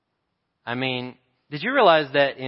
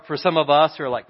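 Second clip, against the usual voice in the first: normal talking voice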